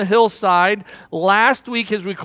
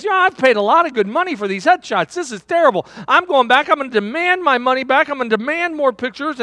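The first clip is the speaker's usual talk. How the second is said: higher-pitched indignant rant, building; mock angry rant; 6 semitones higher than usual. Politician venting about wasted money, in hammed-up indignation. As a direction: play the outraged customer, voice raised high. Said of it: "And he got angry".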